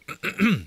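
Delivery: nervous throat clear before speaking. Acted. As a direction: clear the throat to get attention. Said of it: "Ralph cleared his throat".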